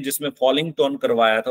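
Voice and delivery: usual speaking voice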